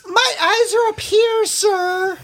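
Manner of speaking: high prim falsetto; falsetto lady voice. Pretend. Falsetto voicing a woman.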